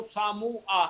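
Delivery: सामू आ with a rounded u in the middle; teacher testing a wrong form; wrong vowel. Shown as a demonstration. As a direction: say सामू आ as the second, awkward variant. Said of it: "pronunciation ले रहा हूँ".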